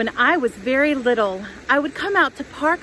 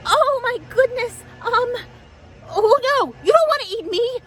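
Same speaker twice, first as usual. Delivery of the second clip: high, fluttery little goat voice; high pleading goat; 13 semitones higher than usual. Acted. Tilly begging the troll not to eat her, high and fluttery. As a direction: plead like a nervous small goat.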